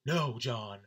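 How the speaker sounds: loud mock-angry outburst, building to a yell; imitating pissed-off friend. Angry friend answering "You okay?", mock furious. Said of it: "he just had this pissed".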